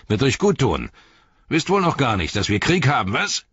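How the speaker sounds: high, forceful taunt; mocking bully's scolding; jeering slave driver. A narrator's reading. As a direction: goad them, swooping up at the end. Slave driver taunts exhausted prisoners.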